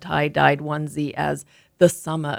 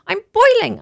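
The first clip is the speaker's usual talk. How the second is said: put-on high, louder child's whine; whining; 9 dB louder than usual. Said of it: "I whined".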